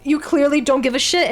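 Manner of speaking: high, loud, heated accusation; indignant rant. Real, not acted. Streamer calling out privileged centrists, indignant rant. Ranting at imagined apolitical centrists.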